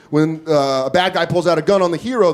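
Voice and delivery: usual speaking voice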